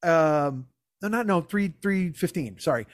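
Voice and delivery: normal talking voice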